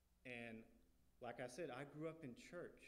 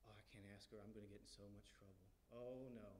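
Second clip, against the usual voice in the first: much softer, lower worried muttering; nervous inner voice; 4 semitones lower, 9 dB quieter, faster than usual. Retold or imitated. Nervous kid afraid to ask mom, hushed worried thought. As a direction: think it to yourself, hushed and nervous.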